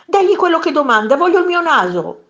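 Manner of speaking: fast, high, loud command; urgent shouted order. A narrator's reading. Master to maid, wanting his nose, urgent shouted order. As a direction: yell it in a high rush.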